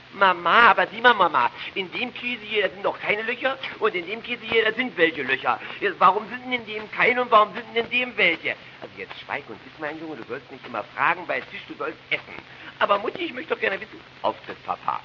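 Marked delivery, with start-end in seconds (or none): 0.1-8.6 put-on whiny boy voice. Boy whining to mother about cheese holes. 12.8-14.0 put-on whiny boy voice. Boy whining to mother about cheese holes.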